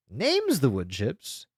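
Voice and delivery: ordinary delivery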